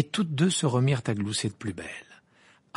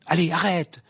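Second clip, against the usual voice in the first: raised, loud impatient protest; irritated; 6 semitones higher, 7 dB louder than usual. A narrator's reading. Ron pressing Hermione to give in, irritated. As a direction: brush off her refusal impatiently. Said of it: "d'un air agacé".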